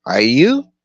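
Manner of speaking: high, loud rising question; Brown's voice. Acted. Brown turning the question on the gunslinger, high and loud. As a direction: throw the question back up high.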